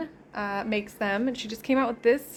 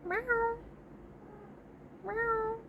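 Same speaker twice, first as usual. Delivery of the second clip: mock meow; 13 semitones higher, 9 dB quieter than usual. Meowing with the cat.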